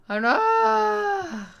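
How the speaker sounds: high, loud drawn-out wail; wailing dismay. Real, not acted. Dreading the song.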